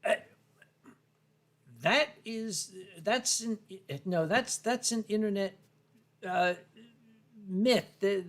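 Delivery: ordinary talk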